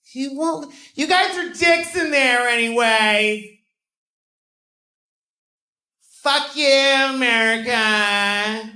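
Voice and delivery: yelling a hammed-up Mexican accent at the border; mock Mexican accent. Comic at the border yells in a mocking Mexican accent. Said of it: "a Mexican at the border".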